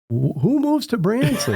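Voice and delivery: who would ever move there?; incredulous. Retold or imitated. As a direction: pitch shoots way up in disbelief. Incredulous retort.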